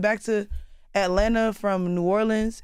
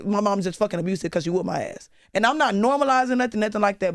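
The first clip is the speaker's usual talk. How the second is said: usual speaking voice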